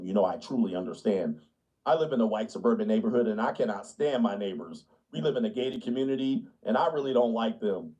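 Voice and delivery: lightly voiced smug suburban liberal; hint of patronizing sympathy; mock liberal. Liberal voice, lower pitched.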